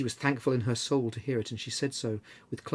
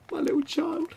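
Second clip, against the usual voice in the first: much higher, grief-stricken cry. A narrator's reading. Father to his family, crying out in grief. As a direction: let the grief break the voice. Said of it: "cried Bob".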